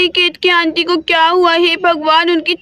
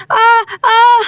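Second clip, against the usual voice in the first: louder drawn-out groans of pain; 4 semitones higher, 3 dB louder than usual. Acted. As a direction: moan through the contraction. Ketaki in labor pain.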